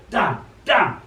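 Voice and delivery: loud cross outburst; wall shouting. A narrator's reading. Wall shouting as the dog steals the ball, loud. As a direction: curse it out as the wall. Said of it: "shouts the wall".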